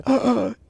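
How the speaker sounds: high sharp gasp of delight; excited fan gasp; mock gasp. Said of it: "all the fans are just like".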